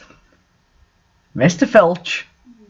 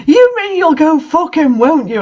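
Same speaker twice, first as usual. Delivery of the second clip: hammed-up jealous shout, much higher; mock angry shout; 10 semitones higher than usual. A narrator's reading. Narrator accuses Vampire, mock jealous angry shout. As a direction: overplay the outrage. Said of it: "I shouted angrily".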